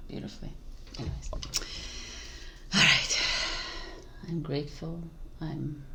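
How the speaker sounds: soft, quiet affirmations; soft murmur. Real, not acted. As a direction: murmur gently to the camera.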